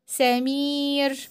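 سمير with the ee held very long; slow stretched سمير. Shown as a demonstration. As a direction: drag out the ee of سمير. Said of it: "مد بالياء".

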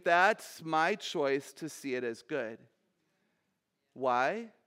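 normal talking voice

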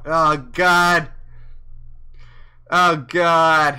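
loud mock despair. Reacting to reaching the goal.

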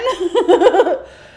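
high bursting laugh; big giggle; loud laugh. Real, not acted. Laughs after greeting.

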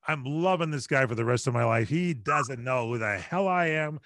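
ordinary delivery

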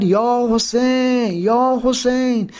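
drawn-out religious cry, pitched high; imitated crying out. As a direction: mimic a mourner calling out, stretching each word. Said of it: "ظهر عاشورا یک مرتبه داد زد". (فریاد کشیده و عزادارانه، به تقلید)

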